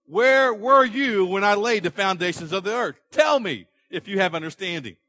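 God demanding an answer from Job; thundering God. Acted.

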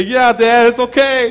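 put-on lightly high childlike comfort; a hint of a child's voice, soothing; comforting kids. Kids comforting their dad, lightly childlike.

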